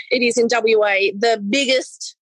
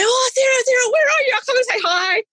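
excited greeting; 11 semitones higher than usual. Retold or imitated.

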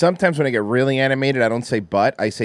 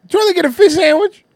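put-on high, fast quoted line from an old clip; high-pitched throwback meme quote; mock clip voice; 7 dB louder, faster than usual. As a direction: squeak it out like the meme. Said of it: "I got a throwback one for you".

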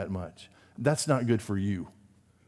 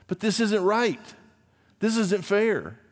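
higher, complaining voice of a hurt believer; mock complaint to God, pitched up; whining protest; 9 semitones higher than usual. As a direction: plead that it's unfair.

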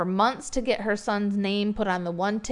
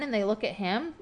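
normal talking voice

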